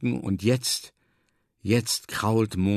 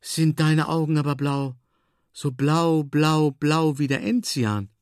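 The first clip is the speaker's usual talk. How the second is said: higher voice, drawn-out words of wonder; sudden admiring exclamation; 4 semitones higher than usual. A narrator's reading. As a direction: marvel aloud, stretching the repeated word. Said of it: "rief sie plötzlich". (staunender, bewundernder Ausruf)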